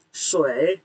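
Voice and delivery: "Sui" with a dipping third tone, down then up; nada ketiga. Shown as a demonstration. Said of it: "nada tiga ya". ("Sui" nada tiga, turun lalu naik)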